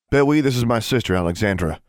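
pointed introduction, starting high and loud; high attack settling to normal; sharp. A narrator's reading. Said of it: "Maximilian's voice became sharp".

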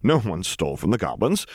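ordinary delivery

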